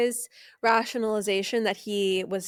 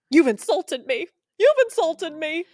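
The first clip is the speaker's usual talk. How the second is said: loud, higher, hammed-up wounded cry; mock offended Gary; 7 semitones higher, 7 dB louder than usual. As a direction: overplay his melodramatic outrage. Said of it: "and being like".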